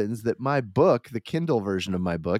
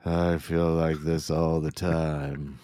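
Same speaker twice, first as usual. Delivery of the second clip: slow, lower droning mock sick voice; mopey sick voice; 7 semitones lower, 3 dB quieter, slower than usual. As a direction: imitate a dull sick drone. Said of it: "your sick voice sounds the same".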